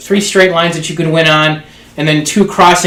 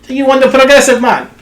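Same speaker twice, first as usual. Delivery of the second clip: put-on a higher-pitched Bahamian accent for the lady; 9 semitones higher than usual. Bahamian lady at the casino announces his win, higher Bahamian accent. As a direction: play the Bahamian casino lady telling him he won. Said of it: "Is that exaggeration, or is that how she really talks".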